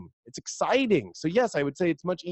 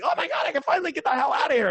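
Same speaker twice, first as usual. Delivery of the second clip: fast, high, giddy escape cry; freed monkey; 11 semitones higher, much faster than usual. Retold or imitated. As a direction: burst out like a monkey let loose. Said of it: "Like, it's like".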